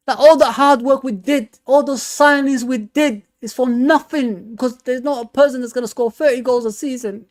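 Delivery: anguished worry. Real, not acted. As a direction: sound pained at losing it all. Worried about Salah leaving.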